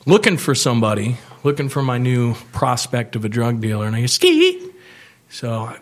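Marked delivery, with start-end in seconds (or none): 4.1-4.6 high, loud hiss-call to the street; a sharp high street call. Retold or imitated. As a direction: call out to grab his attention. Calling out to a dealer.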